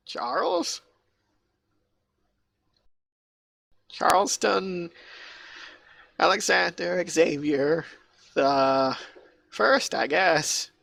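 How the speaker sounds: high, slow, unsure guard voice; nervous guard. Acted. Guard giving his name to the arbitrator, timid and hesitant. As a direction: give your name as if guessing at it.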